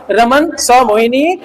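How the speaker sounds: slow, word by word; teacher reads the correct order slowly; slow model sentence. Shown as a demonstration. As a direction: spell the order out slowly for the class. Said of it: "अब sentence अच्छा कैसे".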